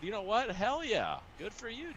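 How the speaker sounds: delighted, high-pitched cheer; high and loud, thrilled for them; loving it. Retold or imitated. Coach retelling his praise for a student who beat him, loving it. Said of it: "I was loving it".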